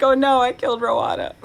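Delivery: loud, laughing, playful guilt; laughing mock horror. Teacher joking about upsetting Rowana, laughing mock horror. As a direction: laugh through a mock confession. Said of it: "I'm like".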